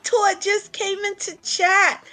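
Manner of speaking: real fan excitement breaking through; high, squealing excitement; fangirling. Real, not acted. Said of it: "I'm fangirling".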